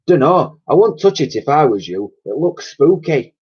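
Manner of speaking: put-on high, loud cockney old dear; old cleaning lady voice. A narrator's reading. Old cleaner warning the girl, fussy cockney voice.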